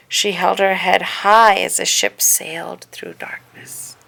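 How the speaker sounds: usual speaking voice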